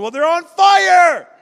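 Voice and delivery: high, slow, loud exclamation; preaching shout. Real, not acted. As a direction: proclaim it at the top of the voice. Preacher hits the climax.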